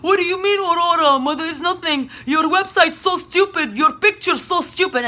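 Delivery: high, sneering put-on voice; scoffing at her website, mocking; mocking Tatiana. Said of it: "she's like".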